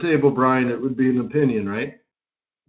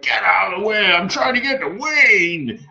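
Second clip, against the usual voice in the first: higher, brusque push through the crowd; impatient billionaire; 5 semitones higher than usual. Retold or imitated. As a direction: elbow your way to Wayne. Billionaire pushing through room.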